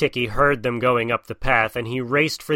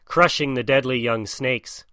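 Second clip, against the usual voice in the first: ordinary delivery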